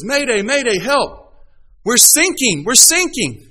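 high, loud panicked plea; desperate distress call. Acted. Sinking sailor calling the coast guard, panicked. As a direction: beg for help as the boat goes down. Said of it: "And he says".

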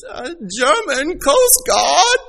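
high, slow put-on German accent; German trainee. New German trainee answering the radio, mock accent. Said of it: "And he goes".

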